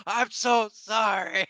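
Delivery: slow, high, laughing sorry; laughing through the apology to Gary. Acted. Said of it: "It's like".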